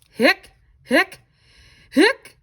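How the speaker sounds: high, loud, jolting hiccups; loud squeaky hiccups. Acted. Reading to kids, the skeleton hiccups loud and high. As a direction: jolt out each hic high and loud.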